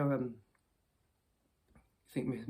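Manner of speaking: usual speaking voice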